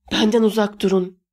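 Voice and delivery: ordinary delivery, nothing marked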